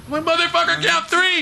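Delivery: high hammed-up shout of the count; mock referee count. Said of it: "the referee takes a".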